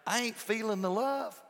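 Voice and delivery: casual slangy complaint; vernacular slang. Retold or imitated. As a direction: say it like street talk. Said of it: "our vernacular".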